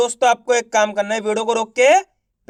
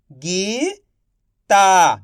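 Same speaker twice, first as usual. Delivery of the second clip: slow stretched reading; much slower than usual. Shown as a demonstration. Teacher to pupils, slowly stretched. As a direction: draw Gita out slowly. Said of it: "आप इस तरीके से".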